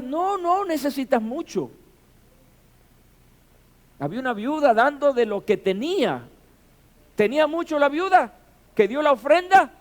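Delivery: high, loud rhetorical challenge; impassioned preaching. Real, not acted. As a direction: fire the questions at the congregation. Preaches emphatically with rhetorical questions.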